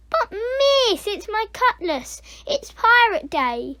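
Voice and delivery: high, pleading protest; child's protest. Acted. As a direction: argue with teacher, starting high. Pupil protesting to teacher.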